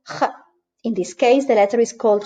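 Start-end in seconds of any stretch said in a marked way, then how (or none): 0.1-0.3 J as a throaty "kha" sound; J as the characteristic Spanish guttural. Shown as a demonstration. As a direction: scrape J at the back of the throat. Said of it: "the guttural J".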